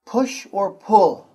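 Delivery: slow, loud, clear model; model phrase for repeating. Shown as a demonstration. Teacher modelling a phrase for the students to repeat. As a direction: say it slowly for the class to copy. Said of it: "I say, you say".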